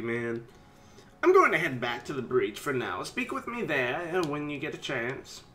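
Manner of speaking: ordinary delivery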